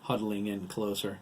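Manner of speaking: ordinary delivery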